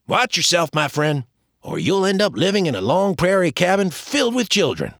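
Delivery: teasing James. Acted. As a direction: tease him like an old friend. James warning jokingly.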